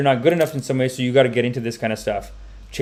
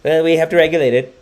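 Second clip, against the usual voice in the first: mock politician. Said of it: "how the politician thinks".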